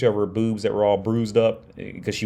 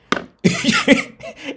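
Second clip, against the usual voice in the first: a breathy high-pitched giggle; amused high cackle; 4 dB louder than usual. Real, not acted. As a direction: let a squeaky laugh slip out. Laughs at his own joke.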